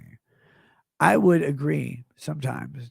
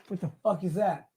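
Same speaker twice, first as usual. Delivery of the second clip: normal talking voice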